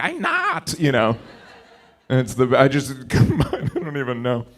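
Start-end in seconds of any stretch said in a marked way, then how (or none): 0.0-0.8 squeaky high pet voice through a laugh; voicing the dog. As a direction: give the dog a tiny high voice. Voicing his dog's reply.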